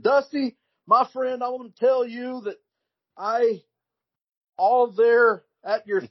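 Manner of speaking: high, loud, halting mock promo voice; awful wrestler promo. Mocking Doctor Death's rambling promo to Dusty, high and loud. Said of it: "one of the worst promos I've ever seen".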